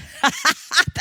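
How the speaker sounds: bright embarrassed laugh, high. Real, not acted. Host laughing at herself after slipping at the sink. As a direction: laugh it off after a near fall.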